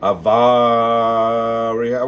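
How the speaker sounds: Avaria- with one vowel hugely stretched; sounded out. Shown as a demonstration. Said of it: "So sound it out".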